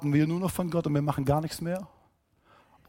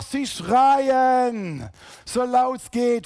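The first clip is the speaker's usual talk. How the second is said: \"schreien\" and \"laut\" called out full voice, louder; shouted; 4 semitones higher, 4 dB louder, much slower than usual. Shown as a demonstration. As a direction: shout the line out like the blind men crying. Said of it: "Da bin ich heute Morgen sehr leise dagegen".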